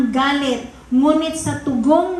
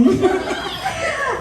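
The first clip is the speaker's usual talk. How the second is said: high laugh; 12 semitones higher than usual. Real, not acted. Laughing at the imitation.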